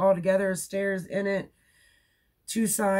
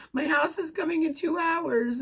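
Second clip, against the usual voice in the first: much higher, squeaky panic; squeaky excited voice; 13 semitones higher than usual. Retold or imitated. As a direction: squeak it out, overwhelmed. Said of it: "all squeaky in my voice".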